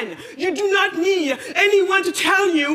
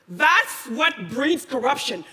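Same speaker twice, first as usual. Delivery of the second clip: drawn out, each word weighted; slow scornful verdict; much slower than usual. Real, not acted. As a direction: spell out the blame slowly. Closing condemnation of laws.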